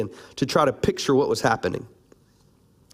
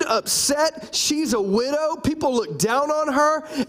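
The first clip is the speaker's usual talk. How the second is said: high emphatic preaching; 10 semitones higher, slower than usual. Real, not acted. Preaching Tamar's hurt.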